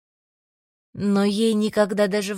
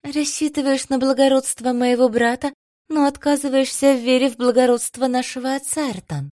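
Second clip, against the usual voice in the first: higher, louder, mocking; 7 semitones higher, 3 dB louder than usual. A narrator's reading. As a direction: tease him with a raised brow. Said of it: "Насмешливо подняла бровь она".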